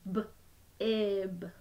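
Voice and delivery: each sound said separately with a short break; sounds tapped apart. Shown as a demonstration. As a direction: split the word into its sounds, one by one. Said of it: "Can you do that with me?".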